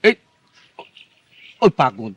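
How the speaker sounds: ordinary talk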